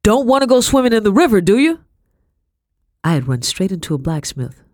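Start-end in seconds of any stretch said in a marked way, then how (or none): 0.0-1.8 loud, fast booming tease; booming teasing. Acted. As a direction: boom a jolly warning. Blacksmith keeps booming, teasing.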